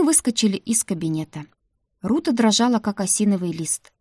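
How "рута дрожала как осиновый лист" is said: ordinary delivery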